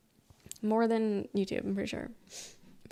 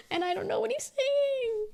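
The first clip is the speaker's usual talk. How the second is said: mock wailing complaint; 12 semitones higher than usual. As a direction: play up helpless confusion in a squeaky-high pitch. Comic complaint about the thick accent.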